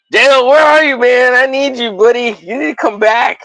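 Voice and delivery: hammed-up plea to a buddy; mock pleading. Pleading for a viewer to return.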